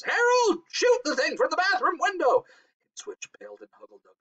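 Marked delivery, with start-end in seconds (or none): 0.0-2.4 very high, screeching command; piercing, high-pitched urgent cry; cried out. A narrator's reading. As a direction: screech orders at your husband. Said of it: "cried Missus Blevins".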